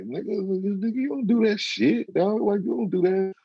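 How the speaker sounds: high, exasperated mock scold; mock scolding a young guy. Older man scolding a young guy online, high and exasperated.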